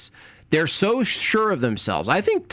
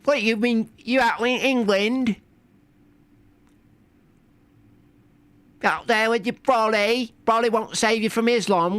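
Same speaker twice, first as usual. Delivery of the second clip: put-on hammy British accent, pitched up; 10 semitones higher than usual. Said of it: "the only way I could do a British accent".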